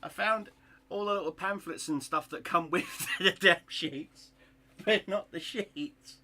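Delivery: loud, high, laughing in disbelief; exasperated laughing shout. Real, not acted. Hobbyist finds pamphlets but not the sheets, exasperated laughing shout. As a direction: blurt it out half laughing, fed up.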